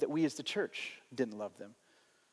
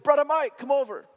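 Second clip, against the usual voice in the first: loud, high call across the street; imitated yell; 9 semitones higher, 6 dB louder than usual. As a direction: holler over to a neighbor, imitating him. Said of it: "he yells over".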